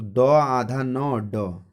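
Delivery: spelling द आधा न ड very slowly. Shown as a demonstration. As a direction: spell each letter out slowly for the class. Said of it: "हम लिखेंगे दंड". (अक्षर-अक्षर धीरे बोलना)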